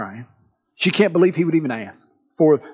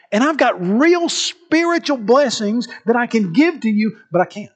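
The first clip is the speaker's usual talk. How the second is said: higher-pitched, emphatic sermon delivery; impassioned preaching; 6 semitones higher than usual. Acted. Preacher voices God urging listeners to let go, fervent. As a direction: press each point home as God's own plea. Preaches God's message with force.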